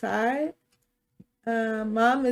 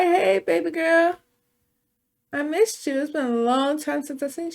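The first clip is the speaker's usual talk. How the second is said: father's greeting; 5 semitones higher than usual. Acted. Voicing the father greeting daughter.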